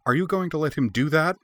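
high, shocked demanding voice; aghast demand. A narrator's reading. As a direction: demand it, appalled. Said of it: "she demanded, aghast".

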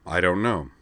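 plain reading voice

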